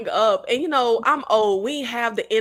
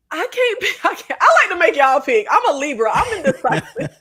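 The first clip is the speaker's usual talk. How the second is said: laughing; 4 semitones higher, 5 dB louder than usual. Real, not acted. Laughing at own indecision.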